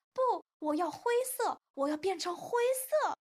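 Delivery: put-on high, loud childish tantrum; shrieky insistent whining demand; tearful protest. A narrator's reading. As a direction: refuse like a child about to bawl. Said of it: "哈瓦尔哭了起来".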